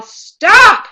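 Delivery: loud, high yell of an adult breaking up a fight; forceful shout, easing into a slow, loud warning; yelled command. Shown as a demonstration.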